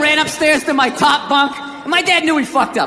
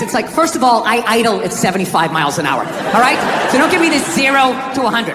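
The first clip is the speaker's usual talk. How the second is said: ordinary talk, nothing marked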